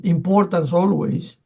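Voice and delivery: ordinary talk, nothing marked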